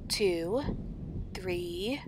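ordinary delivery, nothing marked

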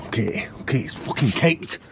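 unvoiced whisper; quiet whispered swear. Real, not acted. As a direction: whisper it in dismay. Grease caked on.

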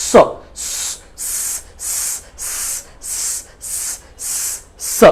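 drawn-out sharp স hiss, repeated; hissed s sound. Shown as a demonstration. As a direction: say স as a thin hissing s. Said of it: "প্রথম সাউন্ডের নাম হচ্ছে স". (চিকন সুরে টানা স)

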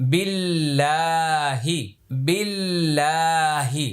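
বিল্লাহি drawn out slowly for repeating; slow clear model. Shown as a demonstration. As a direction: say বিল্লাহি slowly for learners to copy. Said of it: "এবার আগেরটা পরেরটা". (ধীরে বিল্লাহি)

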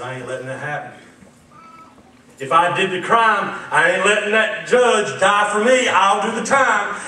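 mild stubborn granddaddy voice; defiant old man. Retold or imitated. Grandfather refusing to let the judge die, defiant. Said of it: "He said".

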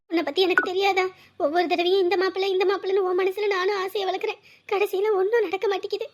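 soft, low, sorrowful voice; tearful lament. Acted. Mother grieving over her daughter's failed matches, softly tearful. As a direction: grieve quietly, close to tears.

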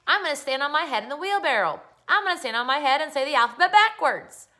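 put-on high, loud boy's boast; yelling. A narrator's reading. Boy showing off to Dad from the wheelbarrow, yelling. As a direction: yell proudly for Dad to watch. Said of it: "and yelled".

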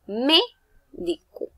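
"médico" slow, MÉ punched high; stress on first syllable. Shown as a demonstration. Teacher showing an esdrújula, "médico" with a high, slow MÉ. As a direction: stretch and hit MÉ, then step down "di-co" in "médico". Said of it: "La mayor fuerza de voz tiene en la me".

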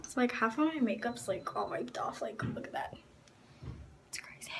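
soft whisper. Real, not acted. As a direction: hush it, just breathed. Whispering about her makeup.

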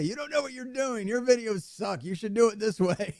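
high, whiny imitation of a commenter; mock commenter. YouTuber mocking his comment section critics, high whine. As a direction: sneer like a know-it-all commenter. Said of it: "I get one of those a day".

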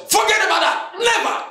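very high-pitched, insistent dismissal; loud faith. Acted. Preacher acting out a refusal to accept bad news, high and emphatic. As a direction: wave it off in a high cry. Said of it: "That's loud faith".

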